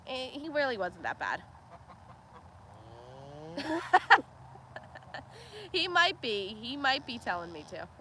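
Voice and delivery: ordinary delivery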